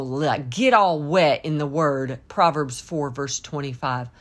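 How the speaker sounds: ordinary delivery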